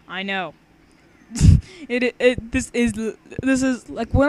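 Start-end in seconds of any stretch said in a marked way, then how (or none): 1.3-1.8 sudden loud high laugh. Real, not acted. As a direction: burst out laughing. Laughs at own ripoff.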